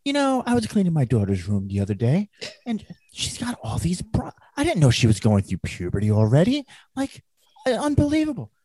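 high, swooping comic character voice; Gilbert Gottfried impression. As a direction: act the mother lightly, pitch leaping up.

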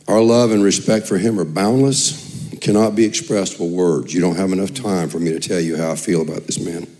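normal talking voice

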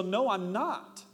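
playing his wife digging in during the argument; mock heated denial. Acted escalating quarrel with wife.

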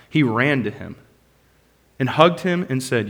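ordinary delivery

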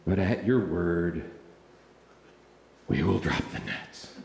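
low, soft, reluctant concession; grudging compliance. Acted. Peter agrees to drop the nets, grudgingly. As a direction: give in quietly, with audible reluctance. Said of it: "he said it just like that".

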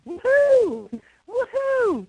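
high, loud hooting like an owl; owl hoot imitation. Caller imitates the owl hooting outside, loud hoots. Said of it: "the owl was going".

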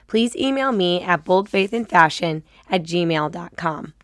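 ordinary talk, nothing marked

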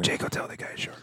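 hushed conspiratorial whisper. Real, not acted. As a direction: lean in and whisper the tease. Whispered aside during banter.

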